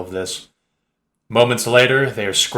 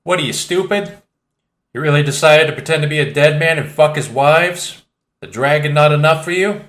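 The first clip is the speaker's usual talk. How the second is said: high, louder insulting Cockney; 6 semitones higher, 4 dB louder than usual. Acted. Cockney man berating Gorka, insulting. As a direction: sneer the insults. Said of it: "The man insults".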